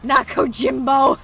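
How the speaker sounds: mocking disbelief. Real, not acted. Streamer mocking a dying character, incredulous. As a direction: scoff at the character's weak line.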